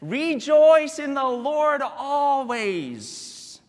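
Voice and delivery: slow, lifted proclamation; scripture reading. Acted. Pastor reading Paul's words to the congregation. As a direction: proclaim the verse slowly. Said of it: "it says".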